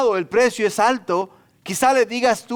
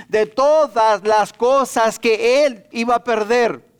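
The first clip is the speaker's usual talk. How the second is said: slow, each word of TODAS LAS COSAS punched higher; weighty, raised preacher's emphasis on "todas las cosas"; emphatic preaching; 5 semitones higher, 4 dB louder, slower than usual. Real, not acted.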